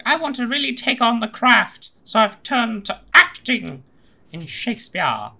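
put-on high, drawn-out Shakespearean actor accent; put-on thespian accent. Podcaster mocks actors turning to theatre, hammy thespian accent. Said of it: "That was a perfect accent".

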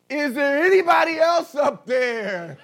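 high, loud panicked call upward; hammed-up panic shout for another God; desperate shout. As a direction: yell up in comic desperation. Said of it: "he looked up and he said".

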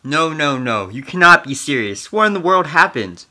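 loud, high, shaken denial; shocked disbelief. Acted. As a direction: refuse to believe the news. Hears leader was assassinated.

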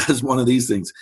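normal talking voice

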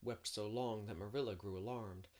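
ordinary delivery

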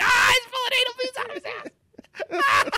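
high, slow, squealing mock outrage; mock shocked yelp. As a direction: shriek it like a scandalized viewer. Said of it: "I was like".

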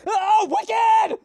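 high, loud shouted exclamation; hammed-up furious yell; angry shout. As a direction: shout it as a new curse. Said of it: "I hope people start using".

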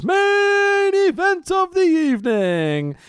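loud, high, drawn-out announcer call; ring announcer. Acted. Host introducing tonight's main event, mock ring-announcer bellow.

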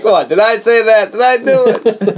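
high, slow, innocent-sounding voice; John Travolta as Michael. Retold or imitated. Imitating the angel denying what he did, high slow mock innocence. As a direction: draw it out in feigned innocence. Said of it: "I like how John Travolta".

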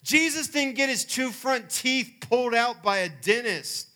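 fervent preaching climax, slow and loud. Acted. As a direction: drive the point home at full force.

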